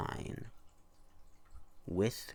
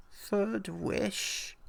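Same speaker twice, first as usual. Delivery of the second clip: put-on baffled old man; 13 semitones higher than usual. A narrator's reading. Baffled old man questions hag.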